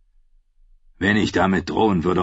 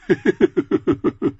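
high, rough giggling from a big man; high giggle; 9 semitones higher than usual. A narrator's reading. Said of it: "kicherte er".

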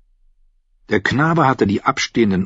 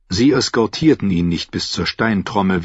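usual speaking voice

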